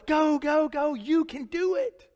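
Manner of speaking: lightly voiced cheerleader; mock cheerleader God. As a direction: cheer like a sideline fan. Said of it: "God's over there going".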